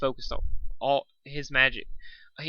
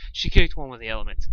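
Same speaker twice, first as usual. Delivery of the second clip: plain reading voice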